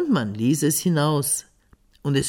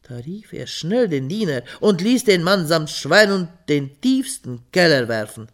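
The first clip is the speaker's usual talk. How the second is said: plain reading voice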